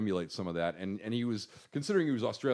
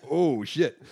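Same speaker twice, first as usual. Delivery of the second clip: mock dismay; 5 semitones higher, 8 dB louder than usual. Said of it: "And he's like".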